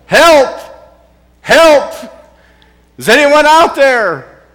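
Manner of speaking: desperate high shouts for help; hollering for help. Retold or imitated. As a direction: yell for help off a cliff. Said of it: "decided to holler for help".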